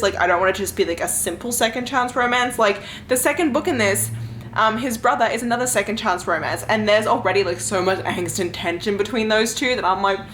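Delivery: ordinary delivery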